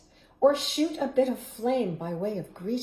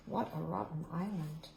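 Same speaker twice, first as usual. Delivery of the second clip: soft, quick murmur to the listeners; hushed aside; 5 semitones lower, 10 dB quieter, faster than usual. Acted. Reader murmurs to the children in a soft, quick aside. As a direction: lean in and say it under your breath.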